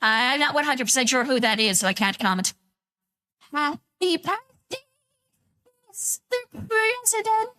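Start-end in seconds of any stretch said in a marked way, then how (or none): 3.5-7.5 much higher, softer, slow filtered voice; sing-song it slowly through a filter; voice changer. Real, not acted. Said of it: "that put a chill down my spine".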